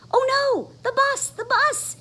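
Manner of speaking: high, loud panicky cry; panicked cry. Acted. Froggy panicking about missing the bus, high cry. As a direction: wail it out in a panic. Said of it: "he cried".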